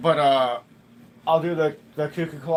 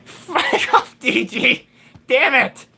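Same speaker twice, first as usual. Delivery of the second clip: loud laughing yell at chat; laughing shout; 10 semitones higher, 6 dB louder, much slower than usual. Real, not acted.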